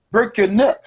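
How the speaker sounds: loud, high [name] call; [name] shout. Retold or imitated. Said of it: "look like [name] and say".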